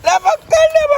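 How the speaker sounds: high, frantic cries to free his leg; panicked pleading. Acted. Stuck leg, begging his friend to pull it out in high panic.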